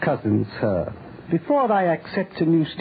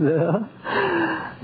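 sinister laugh; 11 semitones higher than usual. Acted. Schoolmaster mocking Watson and Holmes, high sinister chuckle. Villain gloats over drugged victim.

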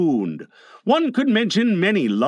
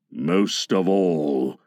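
very low, slow pirate captain; passionate Captain Hook; 10 semitones lower, much slower than usual. A narrator's reading. Hook confides in his boatswain, low slow passion. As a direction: draw it out with dark intensity. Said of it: "Hook was saying passionately".

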